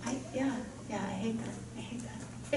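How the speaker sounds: whispered aside to herself. Real, not acted. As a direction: whisper it under your breath. Self-annoyed aside after upspeak.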